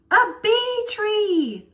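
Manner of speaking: high, slow shouted call; calling back. A narrator's reading. A voice answers the farmer, slow high call. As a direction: shout it back across the field. Voice calls back across chase.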